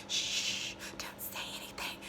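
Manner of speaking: whispered shush mocking Faye. Mocking Faye's late warning, whispered. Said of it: "to wanna drop a glass and be like".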